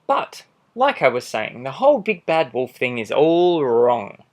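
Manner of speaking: a lightly voiced sly wolf telling his side; charming wolf pleading innocence, animated; wolf narrator. Wolf narrating his own story.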